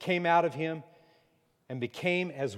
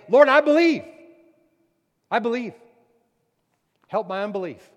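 much louder and higher, screaming it out; desperate scream; 9 semitones higher, 14 dB louder, faster than usual. Acted. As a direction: cry out with everything you have. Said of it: "his head screaming out saying".

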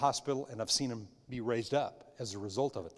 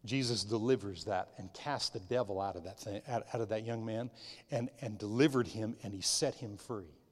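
usual speaking voice

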